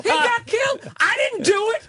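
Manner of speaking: hammed-up guilty man yelling he's innocent; squealing fake panic; badly faked innocence. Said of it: "worst acting job I've ever seen".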